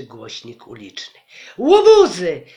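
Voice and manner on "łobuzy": loud, high furious yell; mother shouting loud and high at the mob; angry shout. Acted. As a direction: bellow it at the troublemakers. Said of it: "zawołała nasza mama".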